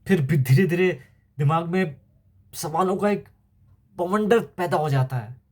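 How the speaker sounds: mounting anguish. Acted. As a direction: build from troubled doubt to a high, faint plea. Soldier's anguished questioning of God.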